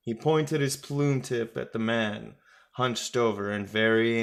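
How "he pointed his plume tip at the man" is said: plain reading voice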